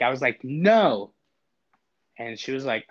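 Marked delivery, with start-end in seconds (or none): 0.4-1.1 firm no. Retold or imitated. Firm refusal to robot.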